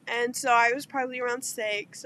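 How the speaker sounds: ordinary talk, nothing marked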